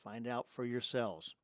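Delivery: usual speaking voice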